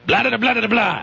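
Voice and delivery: put-on high gibberish Romanian accent; mock Romanian accent. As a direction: babble in a made-up Romanian accent. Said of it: "know what a Romanian accent sounds like".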